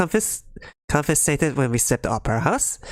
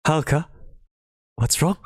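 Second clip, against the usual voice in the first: ordinary delivery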